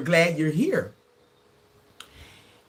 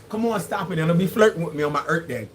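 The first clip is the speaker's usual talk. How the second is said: normal talking voice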